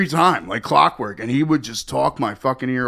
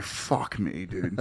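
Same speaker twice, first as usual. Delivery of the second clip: much lower and softer, muttering; muttered under breath; 6 semitones lower, 11 dB quieter, much slower than usual. Retold or imitated. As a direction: grumble it under your breath. Muttered exasperation.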